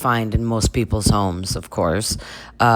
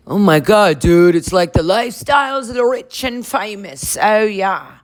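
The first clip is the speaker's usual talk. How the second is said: higher, excited surfer-dude gush; dude-bro voice; 6 semitones higher than usual. Acted. Bro marveling at Lisa's home, dude voice. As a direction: gush like an awed bro at a mansion. Voicing the boys at mansion.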